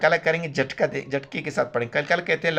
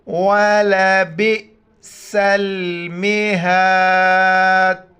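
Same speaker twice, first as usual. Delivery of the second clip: Quran recitation. Shown as a demonstration. Teacher recites the verse for students to repeat after. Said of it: "میرے ساتھ ان الفاظ کو دہرائیے".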